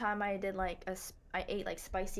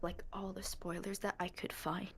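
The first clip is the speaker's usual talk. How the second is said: sudden hushed whisper; 2 semitones lower, 6 dB quieter than usual. Real, not acted. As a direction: drop to a whisper mid-sentence. Drops to unvoiced whisper.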